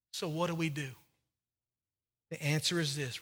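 soft, low pastoral appeal; gentle altar call. Acted. Quiet altar call, communion.

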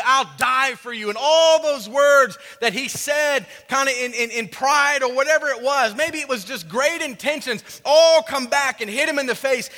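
high, loud preaching at full force; impassioned preaching. Real, not acted.

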